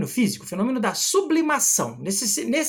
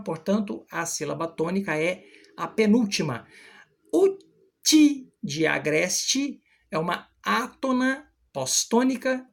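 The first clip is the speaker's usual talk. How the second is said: usual speaking voice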